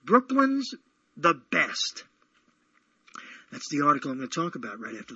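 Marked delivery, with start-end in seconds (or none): 0.1-2.1 announcing headline. Shown as a demonstration. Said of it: "it says".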